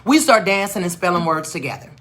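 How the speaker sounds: usual speaking voice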